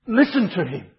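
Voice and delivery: listen hit hard as an order; emphatic command. Shown as a demonstration. Preacher stressing God's command to the church, emphatic. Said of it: "is emphatic".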